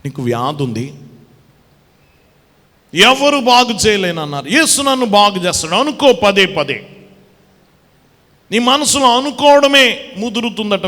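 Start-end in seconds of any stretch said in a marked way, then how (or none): 2.9-10.9 loud, ringing preacher's call, slowing on the last words; preaching at full force. Acted. Preacher calling out loudly to the congregation about the sick woman.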